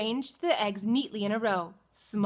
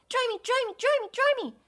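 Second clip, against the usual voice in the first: put-on baby chick voice; 14 semitones higher, much faster than usual. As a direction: greet mama like a fresh chick. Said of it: "cried the soggy baby chick".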